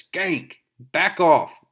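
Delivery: high and loud, shouting her off; Hammerhead snapping. Retold or imitated. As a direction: bark it at her like a thug. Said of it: "And we see that Hammerhead says".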